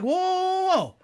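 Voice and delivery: high, drawn-out mock alarm; a hammed-up startled whoa. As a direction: back off, hands up, in a high voice. Retold reply to angry commenter.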